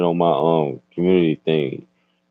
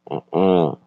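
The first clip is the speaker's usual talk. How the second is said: usual speaking voice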